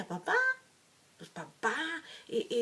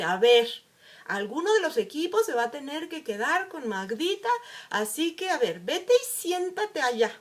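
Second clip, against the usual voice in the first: loud, higher, commanding classroom voice; bossy teacher; 4 semitones higher, 6 dB louder than usual. Retold or imitated. Teacher assigns Magdita to a team, loud and bossy. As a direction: call the class to order.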